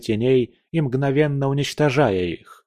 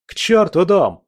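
higher, louder angry cut-in; sharp angry outburst; 8 semitones higher, 5 dB louder than usual. A narrator's reading. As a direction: snap it out, interrupting hard. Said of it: "неожиданно резко перебил".

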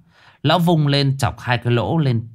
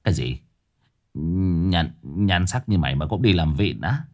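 incredulous master; 5 semitones lower than usual. Acted.

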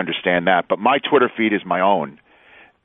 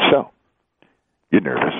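low, dry, teasing question; deadpan tease; 5 semitones lower than usual. Retold or imitated. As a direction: needle him with a straight face. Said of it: "He's very good at the deadpan".

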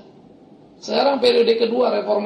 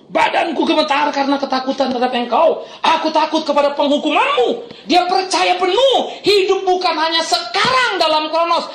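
raised, high-pitched pulpit proclamation; impassioned preaching; 6 semitones higher, 3 dB louder than usual. Real, not acted. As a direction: proclaim it with full conviction, key words pushed high. Preaches at full force. (khotbah lantang berapi-api)

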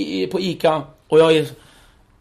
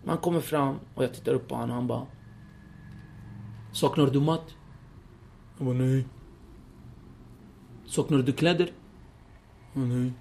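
much softer, hushed retelling; hushed fear; 4 semitones lower, 12 dB quieter than usual. Real, not acted. Son recalling waiting alone, much softer. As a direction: tell it low as if still afraid. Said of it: "fear memory, hushed".

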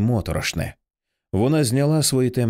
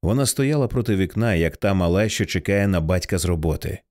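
ordinary delivery, nothing marked

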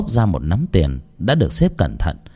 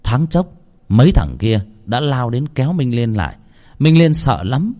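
normal talking voice

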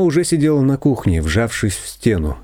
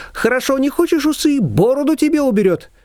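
high, lively neighbour voice; a bossy older neighbour pitched up high; Shushana's voice. A narrator's reading. Neighbour brushing off Nina, high and bossy.

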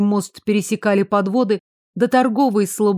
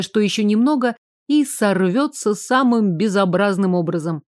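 ordinary delivery, nothing marked

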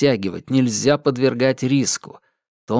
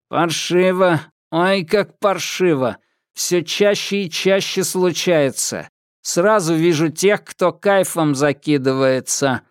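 metallic patrolman; 5 semitones higher than usual. Acted. Patrolman rants about drug users.

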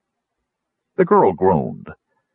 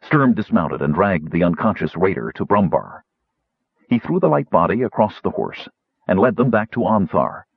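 usual speaking voice

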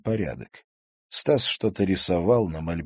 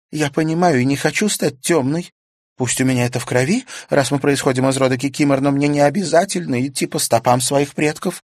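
put-on higher young girl's voice, rueful; girl Karina voiced; 8 semitones higher, 7 dB louder than usual. A narrator's reading. Karina admits her foolishness to Stas, higher girl's voice. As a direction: play Karina, higher and lighter, sheepish.

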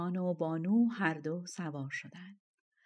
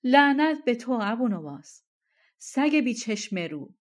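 shouted mock curse; 3 semitones higher, 8 dB louder than usual. A narrator's reading. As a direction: cry out a curse. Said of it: "قاه قاه خنده سر داد و فریاد زد".

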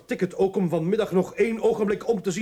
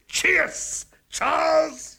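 higher, louder cheerful toast with laugh; merry toast; 5 semitones higher, 4 dB louder, much slower than usual. Acted. Father toasting his butler Charles, merry and higher. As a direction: raise the glass gleefully.

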